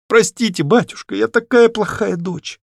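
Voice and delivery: high-pitched, pleading apology; contrite plea. Acted. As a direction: daughter begging her father's forgiveness. Daughter begs father's forgiveness. (виновато, с мольбой)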